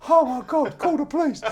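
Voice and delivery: panicked high, loud Etonian yelp; Eton type. Retold or imitated. Posh reporter watching the table get thrown, panicked cry. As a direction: cry out like an alarmed posh man. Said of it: "might have gone to Eton".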